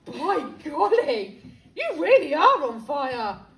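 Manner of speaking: amazed. Acted. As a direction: gasp it out in awe. George amazed.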